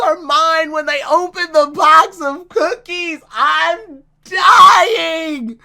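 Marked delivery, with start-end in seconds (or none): 3.3-5.6 high, loud, drawn-out wail; dying of amusement. Real, not acted. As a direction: wail it, overcome by how funny it is. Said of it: "that's so fucking funny".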